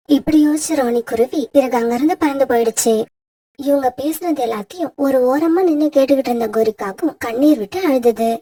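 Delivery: low, even storyteller's voice; story narration. A narrator's reading. Narrator telling listeners how the crow wept, low storyteller read. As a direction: narrate the sad fable steadily for listeners.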